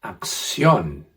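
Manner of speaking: "Acción" with a loud push on ón; stress on final O. Shown as a demonstration. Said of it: "the accent's above the O".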